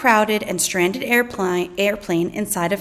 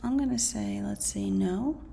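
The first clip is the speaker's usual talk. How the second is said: quiet musing; 10 dB quieter than usual. Acted. Student pondering answer aloud.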